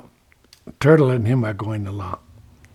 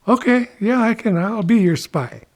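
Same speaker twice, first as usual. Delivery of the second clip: higher-pitched Turkey saying yes; eager agreement; 8 semitones higher than usual. Acted. Turkey agrees to be Ichiko's spy, eager.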